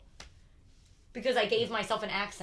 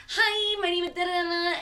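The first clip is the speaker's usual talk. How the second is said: mock intro voice; 10 semitones higher, 7 dB louder than usual. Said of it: "you're doing that whole thing".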